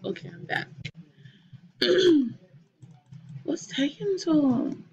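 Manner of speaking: normal talking voice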